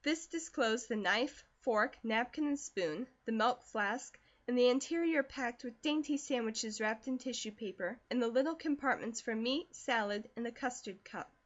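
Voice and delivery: ordinary delivery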